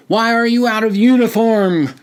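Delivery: high, loud screamed question; officer screaming at a half-dressed recruit. A narrator's reading. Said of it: "screamed one of them".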